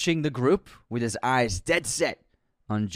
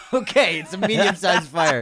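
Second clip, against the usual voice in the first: laughing; 6 semitones higher, 6 dB louder than usual. Real, not acted. Laughing concession.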